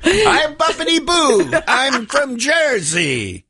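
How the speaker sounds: high, slow, silly put-on accent; a hammed-up fake Jersey voice; mock Jersey accent. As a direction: ham up a terrible Jersey accent. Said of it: "you sound like you're from Azerbaijan".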